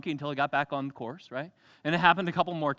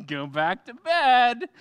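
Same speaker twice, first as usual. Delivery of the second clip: drawn out, high, tired go back to bed; sleepy dismissal; 8 semitones higher, 8 dB louder, much slower than usual. Acted. Eli sending Samuel back to bed, drawn out and weary. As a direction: brush the boy off, slowly. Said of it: "I didn't call you".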